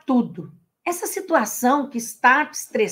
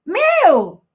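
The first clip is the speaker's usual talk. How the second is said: higher, hammed-up wounded whine; mock paranoid outburst; 7 semitones higher, 3 dB louder, slower than usual. Imitating a coworker who thinks others laugh at her, mock higher whine. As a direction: play the insecure colleague, rising to a yelp.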